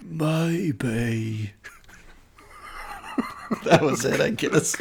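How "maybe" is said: drawn-out bad British accent, "Maybe" as "My-bay"; one-word botched British accent impression; Keanu Reeves. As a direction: stretch "Maybe" slowly in a mock British accent. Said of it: "one word impression".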